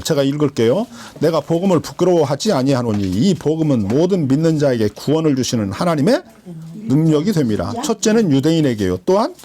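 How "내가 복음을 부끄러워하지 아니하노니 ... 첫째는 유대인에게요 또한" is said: steady, low Bible reading; low formal scripture reading. Acted. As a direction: read the verse out to the congregation. Reads scripture aloud.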